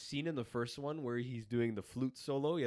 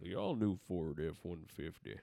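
Sam Elliott impression; 5 semitones lower, slower than usual. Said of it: "You do a really good Sam Elliott impression".

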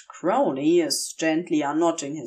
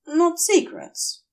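ordinary delivery, nothing marked

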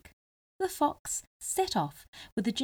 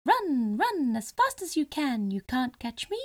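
gingerbread man voice; 7 semitones higher than usual. Acted. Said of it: "he called as he ran".

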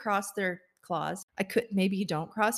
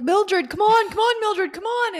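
high, loud urging call; frantic dove husband; 13 semitones higher, 8 dB louder than usual. Acted. Husband dove urges his trapped wife to hurry, high mock panic. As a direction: play a husband dove calling out, pitched way up. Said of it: "He's like".

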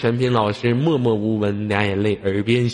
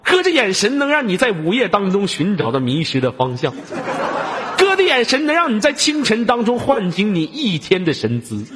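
hammed-up boast; 11 semitones higher, 4 dB louder than usual. Hammed-up self-praise routine.

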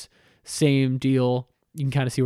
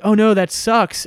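mock dismay; 6 semitones higher, 5 dB louder than usual. Liu Kang reacts to Katana's problem.

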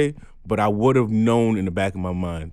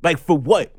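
louder, higher, pressing why; heated demand; 7 semitones higher, 5 dB louder than usual. Real, not acted. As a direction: challenge the person directly. Angry rant at a person.

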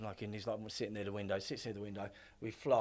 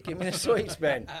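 stern demand; 7 semitones higher, 7 dB louder than usual. Retold or imitated. Demanding sweets from hyper kid.

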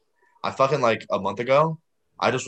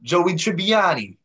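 higher, slow mock New York mafia drawl; hammed-up mafia accent; 7 semitones higher, slower than usual. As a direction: stretch the name out like a mobster. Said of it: "act like we were people in the mafia".

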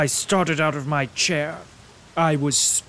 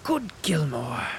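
between sniffs; slower than usual. Acted. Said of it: "he said between the sniffs".

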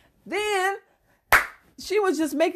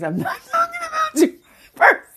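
high, fast, laughing shout; laughing exclaim; faster than usual. Real, not acted. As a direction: squeal it out while laughing. Laughing reassurance to listeners.

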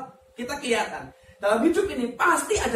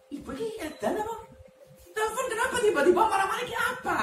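mock bewildered; 11 semitones higher than usual. Pastor's bewildered reaction.

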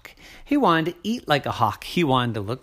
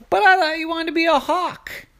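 surprised hawk; 13 semitones higher, 4 dB louder than usual. Acted.

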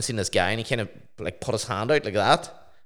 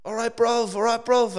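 much higher, chirpy London lad's greeting; London stranger; 13 semitones higher, slower than usual. Retold or imitated. Stranger crossing the road hails him, high chirpy cockney call. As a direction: hail him like a cheeky cockney geezer. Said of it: "this guy ran across the road and started going".

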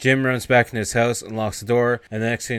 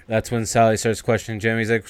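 ordinary talk

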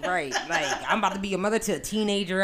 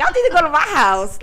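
mock call out; 7 semitones higher, 11 dB louder than usual. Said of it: "I just be like".

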